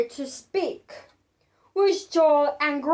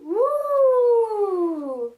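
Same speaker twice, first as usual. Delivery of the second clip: a rising windy woo; wind whoosh; 4 semitones higher than usual. Acted. Narrator voicing the stormy wind, a howling woo. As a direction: blow it out like a gust of wind.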